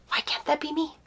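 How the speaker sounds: tearful, voice thinning to a high near-whisper; fighting real tears, barely getting the words out; real choked-up. Real, not acted. Grieving mother on seeing others' pregnancy posts, choked up. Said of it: "I didn't think I'd get emotional".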